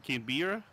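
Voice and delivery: Canberra tried as can-BEER-a, rising questioningly; guessed pronunciation. Pretend. Player trying out a guess, questioning can-BEER-a pronunciation. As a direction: stretch the middle of Canberra into 'beer', as a question.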